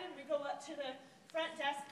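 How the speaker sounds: normal talking voice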